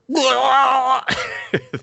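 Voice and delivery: loud, high drawn-out mock wail. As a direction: let out a long, over-the-top cry. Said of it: "needed to see his face go".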